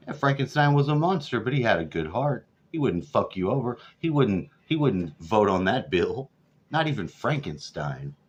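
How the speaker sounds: normal talking voice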